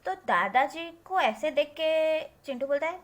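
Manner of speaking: ordinary delivery, nothing marked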